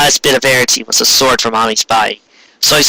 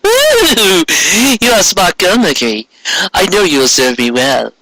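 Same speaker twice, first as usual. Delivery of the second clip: starts much higher, purring approval; pleased queen; 6 semitones higher than usual. Acted. Queen praising Mercury, smug purring voice.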